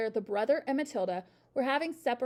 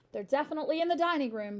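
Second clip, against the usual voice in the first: ordinary delivery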